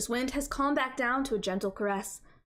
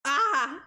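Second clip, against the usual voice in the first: short startled shriek; 4 semitones higher, 4 dB louder than usual. Acted. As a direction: jump at the fright and shriek. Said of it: "my involuntary shriek".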